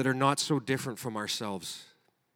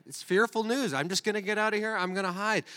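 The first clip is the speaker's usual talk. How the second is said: panicked runaway; 7 semitones higher than usual. Retold or imitated. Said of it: "I have done that as well".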